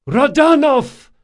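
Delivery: high, loud yell across the deck. A narrator's reading. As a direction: bellow the name at the enemy captain. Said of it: "yelled Drakasha".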